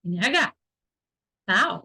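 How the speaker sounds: ordinary talk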